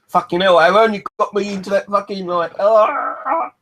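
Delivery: loud frustrated yell; frustrated outburst. Real, not acted. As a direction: blow up in frustration. Frustrated outburst mid-song.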